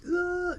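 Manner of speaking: high, loud terrified yell; frightened kid shrieking; loud high scream. Retold or imitated. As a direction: scream out in panic. Kid screaming after beating.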